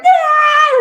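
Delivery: loud, high "aah" outburst; explosive burst of noise; fiery yell. Acted. Acts out her fiery outburst.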